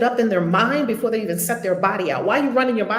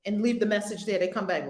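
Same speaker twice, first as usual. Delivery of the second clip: ordinary delivery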